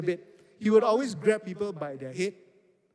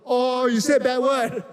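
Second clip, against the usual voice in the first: put-on high, loud and slow, like a tattletale child; mock childish tattling; 6 semitones higher, 7 dB louder, slower than usual. Retelling his camp fight, mocking his young self's slow tattling. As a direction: drag it out like a kid telling on a friend. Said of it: "all my response was".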